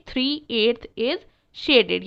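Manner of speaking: "three eighth is shaded" drawn out word by word; very slow model sentence. Shown as a demonstration. Teacher dictating the answer to pupils, very slowly. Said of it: "क्या कहेंगे".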